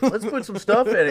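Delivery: high mock bro doctor voice; a squeaky put-on doctor.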